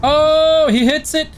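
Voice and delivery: high excited call. Real, not acted. Streamer seeing a shot land, excited call. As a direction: react to the hit with a jolt.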